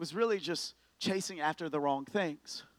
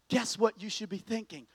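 normal talking voice

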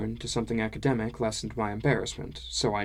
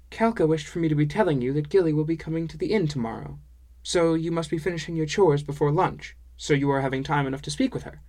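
higher, brisk character voice for a dwarf woman; higher-pitched dwarf woman, briskly relaying news; foreign-sounding dwarf woman; 5 semitones higher, 4 dB louder, faster than usual. Acted. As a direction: pass on the message in a quick, lilting dwarf-woman voice.

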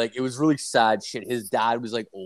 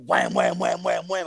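rapid-fire mimicry of pounding; imitated banging; 5 semitones higher than usual. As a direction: voice the repeated bangs on a door. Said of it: "I can hear him banging".

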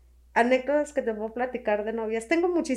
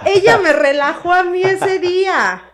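emphatic; 7 semitones higher, 11 dB louder than usual. Real, not acted. Emphatic recounting.

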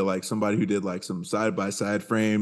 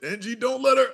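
high-pitched pleading warning; playful high-voiced plea to a character; mock plea; 13 semitones higher than usual. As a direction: plead in a much higher voice. Viewer's mock plea to Denji.

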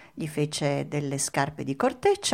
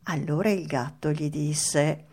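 ordinary delivery

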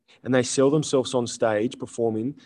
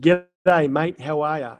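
loud, higher, hammed-up Aussie greeting; put-on Australian accent; 4 semitones higher, 7 dB louder, slower than usual. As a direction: lay the Australian accent on thick. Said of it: "put on the Australian accent".

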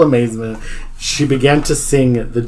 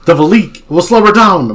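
raised, louder voice; urgent callout; 6 semitones higher, 8 dB louder than usual. A narrator's reading. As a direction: call it out over the action. Character calls out in battle.

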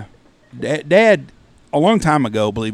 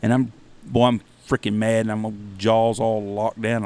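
usual speaking voice

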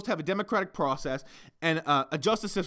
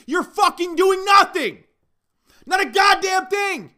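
slow, much higher, much louder yelling; furious shout; 10 dB louder, slower than usual. Real, not acted. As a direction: bellow it out in real fury. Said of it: "yell into a fucking microphone".

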